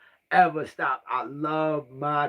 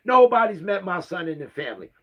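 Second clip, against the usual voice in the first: normal talking voice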